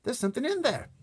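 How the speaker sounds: high, loud amazement; excited girl's cry. A narrator's reading. Sophie sees the dream in the jar, excited cry. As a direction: cry out in wonder. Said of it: "cried out".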